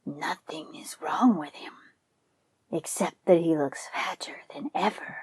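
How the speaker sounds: greedy tiger whispering to himself; a predator's private whispered thought; tiger thinking. Acted. Tiger sizing up the fat buffalo, greedy whisper. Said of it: "and thought".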